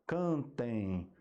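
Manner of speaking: "Cantem" drawn out, lingering on the nasal -em glide; stressing the ending. Shown as a demonstration. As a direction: stretch both syllables of "Cantem" to expose its ending. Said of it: "Esse finalzinho aqui".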